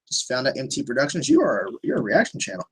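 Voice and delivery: ordinary delivery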